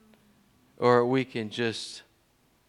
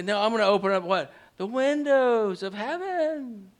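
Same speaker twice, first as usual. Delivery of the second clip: grand, swelling preacher's proclamation; 7 semitones higher than usual. Retold or imitated. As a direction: announce it like a promise from on high. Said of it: "Well, what does he say?".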